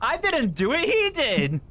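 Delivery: high, loud protesting; defensive protest. Real, not acted. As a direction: protest your innocence loudly. Defending herself, laughing.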